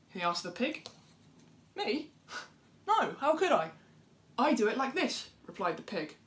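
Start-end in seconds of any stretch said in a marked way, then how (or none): 1.7-5.3 a smug little bird protesting her innocence; innocent animal voice. Acted. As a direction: play the dove, shocked at the idea. Pig denies it, shows how.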